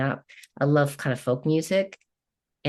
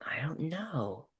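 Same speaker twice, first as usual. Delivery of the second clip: soft breathy whisper, trailing off; whispered unsure; 11 dB quieter, much slower than usual. Real, not acted. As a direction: murmur it to yourself, unsure. Unsure pause before answering.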